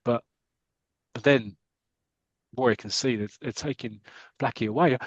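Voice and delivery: ordinary talk, nothing marked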